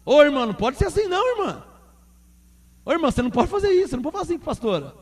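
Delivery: quick, stern scolding of a sister, fast; brisk warning not to talk that way to the pastor; acting out correcting a church member, fast and firm; firm rebuke to a church sister. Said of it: "Quer dizer, chama atenção".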